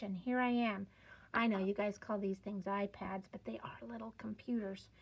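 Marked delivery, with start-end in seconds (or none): 3.3-4.9 whispered aside. Real, not acted. Aside to students about iPads.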